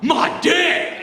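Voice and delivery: high, loud, squealing mock teenage outburst; mock teen yell. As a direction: blurt it out like a cheeky thirteen-year-old. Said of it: "Thirteen-year-olds these days are like".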